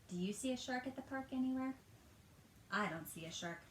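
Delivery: usual speaking voice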